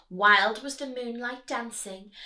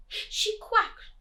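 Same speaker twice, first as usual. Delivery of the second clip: usual speaking voice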